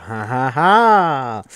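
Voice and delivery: loud, slow, forced ha ha ha; nervous mock laugh. Freaking out at the talking bust, loud forced laugh. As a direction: laugh off the panic.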